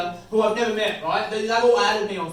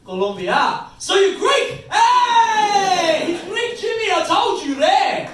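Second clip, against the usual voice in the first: much higher, loud hammed-up yell; excited shout; 12 semitones higher, 8 dB louder, slower than usual. Greek guy yelling to his mate Jimmy, excited. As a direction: call out to Jimmy across the room.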